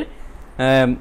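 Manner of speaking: usual speaking voice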